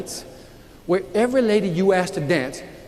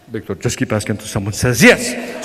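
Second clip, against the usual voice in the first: much lower, suave Spanish mentor in his head; deep mentor voice echoing; 10 semitones lower than usual. Retold or imitated. Remembered mentor Jose urging Victor on in a deep voice. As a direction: let the advice echo low and smooth. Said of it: "talk like Ricardo Montalban with this, like, real deep voice".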